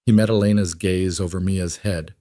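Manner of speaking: plain reading voice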